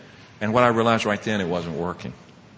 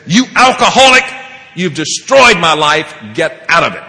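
retold furious yell; 4 semitones higher, 8 dB louder than usual. Son yells the loudest ever at his father, acted loud fury. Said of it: "he yelled at me the loudest".

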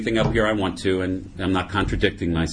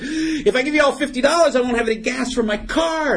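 high-pitched comic protest; backing out. Acted. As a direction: plead your way out of the deal, high and worked up. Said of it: "trying to back out of it".

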